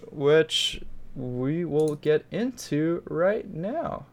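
slow, lilting announcer read; segment announcer. Acted. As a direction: announce it in a high, stretched-out singsong. Hosts the email segment intro.

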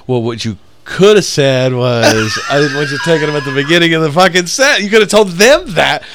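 rising into high, loud mock rant; exasperated retort. Opener silently blaming the comic over pictures, exasperated rant acted aloud. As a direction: build to an exasperated yell.

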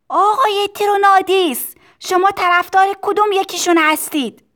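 put-on high child's shout over noise; loud voice. A narrator's reading. Tistu asking over the factory noise, loud high shout. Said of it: "با صدای بلندی گفت".

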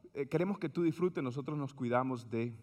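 low, soft fading ad voice; mock calm insurance spokesman; soothing insurance ad. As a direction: sell it smoothly and quietly. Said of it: "decía la compañía de seguro".

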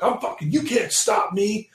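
high, hammed-up angry protest; mock an overheated hothead; worked up, high and drawn out at the end; retold burned-up defiance. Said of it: "all burned up".

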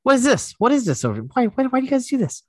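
high, incredulous voice; imitating a baffled skeptic; mock Andrew Santino. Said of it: "The whole time he'd be like".

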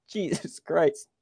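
coughing while trying to talk; choking on something. Real, not acted. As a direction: talk through a choking fit. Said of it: "Sorry, choking on something".

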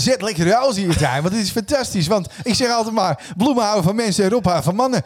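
high-pitched, gushing garden expert; excitable TV gardener impression; Rob. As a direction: enthuse about plants in a high put-on voice. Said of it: "met Rob".

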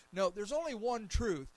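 ordinary talk

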